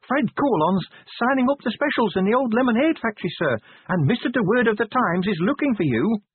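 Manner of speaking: very high-pitched character voice, reporting; high, businesslike sergeant; Cheery's sergeant voice. A narrator's reading.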